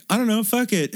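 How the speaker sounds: high-pitched acted shrug-off; hammed-up devil-may-care; mock carefree quote. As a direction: toss it off in a high mocking voice. Acting out characters shrugging it off.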